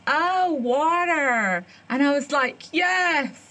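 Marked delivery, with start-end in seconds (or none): 0.1-1.7 long drawn-out, higher-pitched American realisation; waitress finally understanding. Retold or imitated. Waitress finally grasping the order, drawn-out realisation. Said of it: "it dawned on her and she went". 2.7-3.4 higher, drawn-out yes; retold relief. Diner finally understood by the waitress, relieved.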